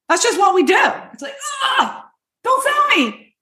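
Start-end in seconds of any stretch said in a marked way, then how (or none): 1.8-3.3 high, put-off groan of refusal; annoyed ugh. Pretend. Shopper recoiling from a salesperson, much higher and annoyed. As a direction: recoil from a pushy seller. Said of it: "when I'm instantly like this".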